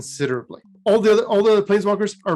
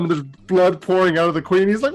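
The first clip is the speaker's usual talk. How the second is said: usual speaking voice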